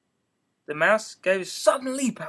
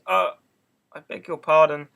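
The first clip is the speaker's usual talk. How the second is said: loud, urgent apology; cry; 9 dB louder than usual. A narrator's reading. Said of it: "Alice cried again".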